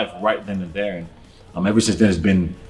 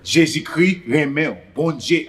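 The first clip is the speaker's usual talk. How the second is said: quick show of Haitian Creole, pitched up; 6 semitones higher, slower than usual. Shown as a demonstration. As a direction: reel off some Creole on request. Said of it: "spit some Creole for us".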